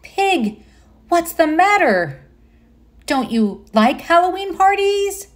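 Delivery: high, alarmed little mouse; worried surprise. A narrator's reading. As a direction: ask in startled concern. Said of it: "Mouse's eyes opened wide".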